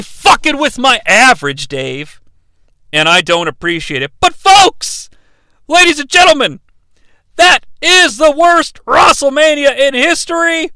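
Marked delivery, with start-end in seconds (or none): 4.2-10.7 high, loud ring announcer proclamation; mock ring announcer. Comic declares worst WrestleMania to the crowd, announcer style.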